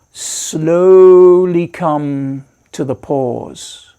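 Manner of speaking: SLOWLY stretched out long, easing into the pause; drawn-out slowing. Shown as a demonstration. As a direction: draw out slowly and coast gradually to a halt. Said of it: "just let the breath".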